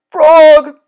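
loud, high call for a friend; storybook yell; crying out. A narrator's reading. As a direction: call out in distress. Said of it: "cried the thing".

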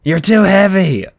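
high, loud, slowed strain; straining Grover. Acted. Grover struggles to hold Percy and Annabeth, straining. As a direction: push it out as if lifting too much. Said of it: "Grover said".

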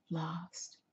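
breathless awed whisper. A narrator's reading. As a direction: breathe the word, spellbound.

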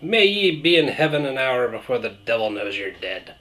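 hammed-up Irish brogue reciting a blessing; cheesy stage-Irish accent giving an old Irish toast; horrible Irish accent. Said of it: "in a horrible Irish accent".

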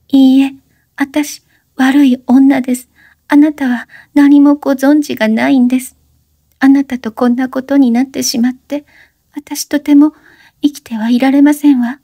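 put-on high young woman's voice, urgent plea; breathless high-pitched plea; Oshino, pleading. A narrator's reading. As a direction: beg him to stop talking. Oshino distressed.

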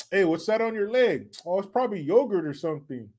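ordinary talk, nothing marked

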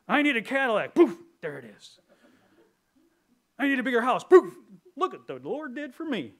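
high, loud, showy demand; mock greedy believer. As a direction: lampoon a name-it-claim-it preacher. Mocking prosperity gospel believers.

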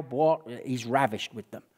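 usual speaking voice

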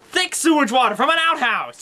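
a high yelled insult; ranting toy shouting insults; mock shout. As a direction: bellow the insult in a rant.

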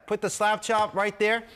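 normal talking voice

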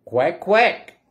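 short, punchy quacks; duck quacking. Acted. Reader voices the duck for children, quacking. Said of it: "called the duck".

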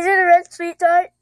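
talking around sour candy; words through a mouthful of candy; mouth full. Real, not acted. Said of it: "You still have the sweet tart in your mouth".

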